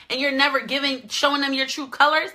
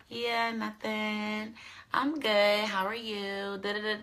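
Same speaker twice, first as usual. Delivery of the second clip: very soft, dainty little voice; quiet, prissy act for a crush; cute and prissy; 3 semitones lower, 10 dB quieter, slower than usual. Shown as a demonstration. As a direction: put on demure sweetness, hushed and drawn out. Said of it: "I get real cute, real, real cute, real prissy".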